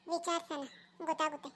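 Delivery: low, soft aside; muttered to himself. Acted. A villager plots to himself, low and soft. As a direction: mutter the plan under the breath.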